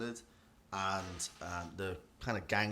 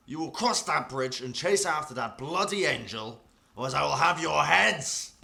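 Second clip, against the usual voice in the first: raised, loud threat building to a shout; angry commander; 6 semitones higher, 10 dB louder than usual. Acted. Defied commander threatening his men, angry building shout. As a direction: thunder a threat at defiant men. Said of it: "clearly does not like this".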